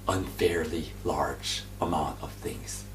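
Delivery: slow, soft whisper; hushed promise. Real, not acted.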